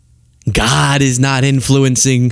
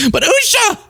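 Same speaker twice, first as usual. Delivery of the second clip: high, flustered mock dodge; mocking Tucker. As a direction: squeak out a flustered excuse. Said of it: "Tucker's like".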